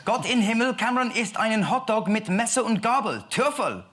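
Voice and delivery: put-on high, loud hammy German accent. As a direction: still over the top German voice. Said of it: "Just bring it down a bit".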